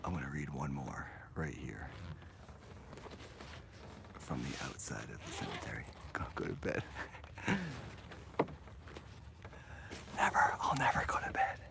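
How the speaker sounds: soft unvoiced whisper. Real, not acted. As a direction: whisper so nobody wakes. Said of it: "I'm going to whisper so I don't wake up the neighbors".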